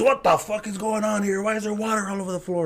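high-pitched, indignant imitation; angry dad. Dad gets up to water everywhere, angry. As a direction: demand answers as a fed-up father. Dad storms out demanding answers.

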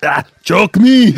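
slow, loud Austrian accent; Schwarzenegger-style Austrian accent; Arnold impression. Shown as a demonstration. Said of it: "Would you say that as Arnold?".

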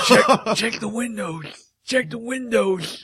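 high, slow, strained plea; imitated wounded man. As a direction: draw it out high, as if lying hurt. Imitates weak wounded man's line.

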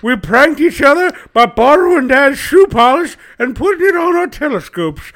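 put-on high crotchety old-timer voice; old prospector. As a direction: scold the whippersnappers like a grizzled geezer. Grumpy old-timer scolding youngsters.

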